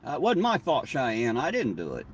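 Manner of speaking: usual speaking voice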